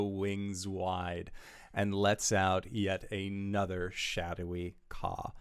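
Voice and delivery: slow, dramatic scene-setting; game master narration. A narrator's reading. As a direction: paint the monster slowly and ominously. GM narrates the creature's appearance.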